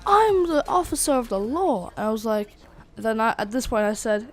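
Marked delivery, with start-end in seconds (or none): 0.1-1.9 high-pitched, indignant mock cop; triggered cop. Triggered cop to boys talking back, high indignant protest. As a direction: assert your authority, pitched well up. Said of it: "he got really triggered".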